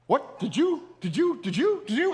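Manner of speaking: mock confusion, tripping over repeated words; acted alarm. Strangers on the beach react to a missile alert, alarmed.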